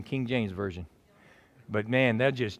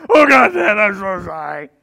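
loud high blubbering apology; father imitating his son's tearful shouting apology; mock crying kid; 6 semitones higher, 12 dB louder than usual. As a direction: wail like a sorry boy. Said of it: "my son came up".

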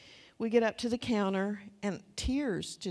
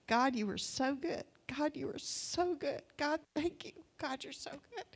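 softer, higher, overcome prayer; not loud; 4 semitones higher, 5 dB quieter than usual. Retold or imitated. Woman thanking God at the store counter, hushed overcome prayer. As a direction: murmur it upward under tearful strain, imitating yourself. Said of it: "just about how I said it".

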